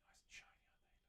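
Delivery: soft admiring whisper. Real, not acted. Admiring the shiny frets in a whisper. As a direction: breathe it out close to the mic.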